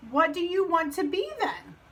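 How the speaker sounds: lightly voiced curious stranger; mysterious new character. Stranger asks Frog what he wants, curious.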